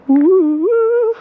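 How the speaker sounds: loud high squeal, then laughs; thrilled yelp into a laugh; high excited ooh. Real, not acted. Riding the log again.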